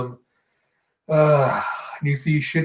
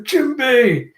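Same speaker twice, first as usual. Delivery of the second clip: high, drawn happy cry; excited greeting; 9 semitones higher, 4 dB louder than usual. Acted. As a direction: call a friend's name in mock delight. Luffy greets Jinbei, acted.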